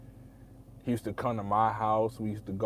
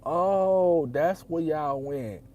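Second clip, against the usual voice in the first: surprised realization; 7 semitones higher, 7 dB louder, much slower than usual. Retold or imitated. Happy learns where they moved.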